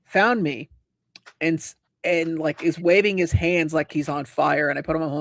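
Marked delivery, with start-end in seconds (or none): none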